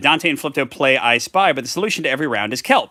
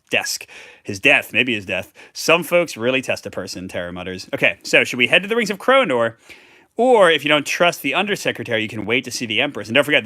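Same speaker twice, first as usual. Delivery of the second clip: ordinary delivery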